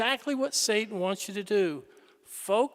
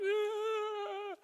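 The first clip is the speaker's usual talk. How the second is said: put-on high soft wail like a baby; mock baby wail; 11 semitones higher, 8 dB quieter than usual. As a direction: cry like a baby at night. Imitating a baby crying at night.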